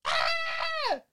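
high, loud shrieking warden; crazed prison warden. Retold or imitated. [name] prank-calling Miranda as a loud crazed warden. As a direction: yell at inmates like a madman. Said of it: "[name]'s like".

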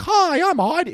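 very high, sweet cartoon girl. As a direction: squeak it like a kids' show character. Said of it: "Where she'd be like".

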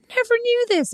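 high, wide-eyed discovery; sending up her own late discovery; mock amazement. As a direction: gasp it out like news. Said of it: "I'm like".

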